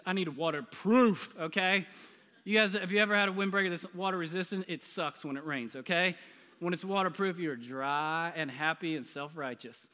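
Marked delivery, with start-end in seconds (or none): none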